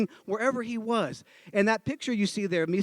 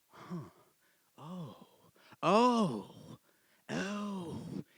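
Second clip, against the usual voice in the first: soft "huh" growing into drawn-out ohs; dawning realization; 8 dB quieter, much slower than usual. Retold or imitated. Friends thinking about it, slowly catching on. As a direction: let the penny drop slowly, oh by oh. Group slowly gets the idea.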